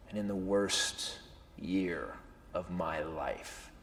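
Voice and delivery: soft, slow and sincere; telling the audience his best night, softly; sincere hush. Real, not acted.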